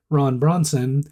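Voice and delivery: ordinary talk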